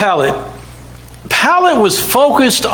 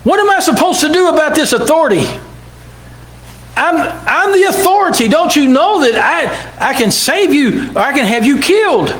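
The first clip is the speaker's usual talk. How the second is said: higher, fast, agitated; troubled Pilate; 6 semitones higher than usual. Retold or imitated. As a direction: torn and pressing. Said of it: "And he said".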